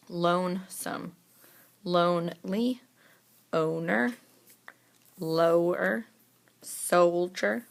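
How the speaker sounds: first syllable stretched in lonesome, lonely, owner; emphasized long O syllable. Shown as a demonstration. Teacher checking the sort, first syllable of each word emphasized. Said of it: "really gonna emphasize the syllable".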